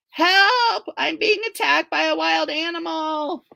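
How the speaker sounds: high, loud call for help; cry for help. Acted. April calling out to the man outside, frightened. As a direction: shout it through the door.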